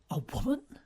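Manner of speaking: surprised rise on "woman"; half-asleep man startled by a woman's voice; startled question. A narrator's reading. Lomelli woken, surprised "A woman?".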